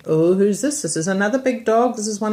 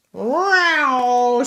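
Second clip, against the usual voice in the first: cat yowl; 6 semitones higher, 4 dB louder than usual. Acted.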